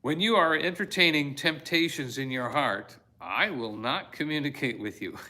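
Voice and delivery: loud reading breaking into laughter; amused reading. Real, not acted. Preacher reading about temptation, tickled and laughing. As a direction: read it out on the edge of laughing.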